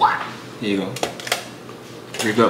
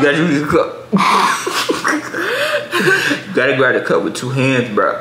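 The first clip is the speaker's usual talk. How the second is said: laughing; 2 semitones higher, 4 dB louder than usual. Real, not acted. Laughing at the cup grip.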